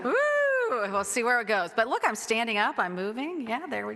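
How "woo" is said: high happy woo; playful whoop. Real, not acted. Pastor shaking out tired legs, whooping happily. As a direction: let out a bright woo.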